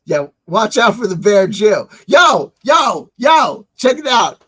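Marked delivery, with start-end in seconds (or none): 2.1-4.4 high, punchy hollered yo's; calling for attention. Real, not acted. Host calling listeners in for a story, excited holler.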